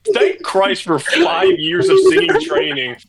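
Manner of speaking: incredulous. Real, not acted. Disbelieving reaction, pitch jumps high.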